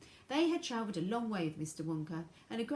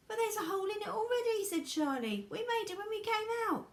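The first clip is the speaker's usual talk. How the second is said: put-on young boy voice; 13 semitones higher, 4 dB louder than usual. A narrator's reading. Charlie high and quick.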